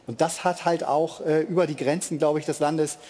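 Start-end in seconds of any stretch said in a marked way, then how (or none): none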